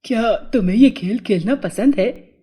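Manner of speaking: put-on boy's character voice, questioning; boy Chhotu. A narrator's reading. Boy asks if the fairy likes football, character voice. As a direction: ask as the boy, in an altered voice.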